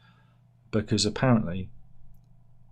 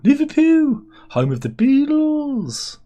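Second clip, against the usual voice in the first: mock announcer; 8 dB louder, slower than usual. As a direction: bellow it like a cheesy TV presenter.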